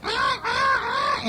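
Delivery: very high, slow squealing "Ah, ah, ah" of a panicked pig; terrified pig squeal. A narrator's reading. Mother pig screaming in terror at the Scamp, terrified squeal. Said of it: "in terror".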